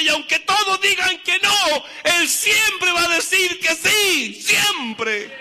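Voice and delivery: rising preaching shout. Real, not acted. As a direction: lift it into a fervent cry. Preacher building to a climax.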